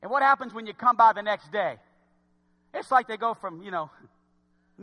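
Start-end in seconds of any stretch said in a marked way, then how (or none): none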